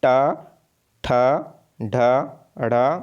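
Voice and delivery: ट, ठ, ढ, ढ़ sounded with long gaps; deliberate retroflex drill, ट, ठ, ढ, ढ़ one by one; pronunciation demo. Shown as a demonstration. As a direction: curl the tongue up for each of ट, ठ, ढ, ढ़. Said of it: "ये भी उच्चारण करके देखिए".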